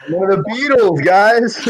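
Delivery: high excited call to the group. Acted. Spotting [name] on stream.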